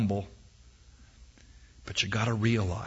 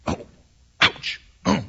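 mock ouch; 2 semitones higher than usual. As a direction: play the poked eaglet's yelp. Mock pain as the eaglet is poked.

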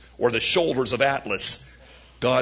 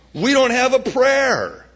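fearful spies; 10 semitones higher, 7 dB louder than usual. Acted. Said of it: "said the ten spies".

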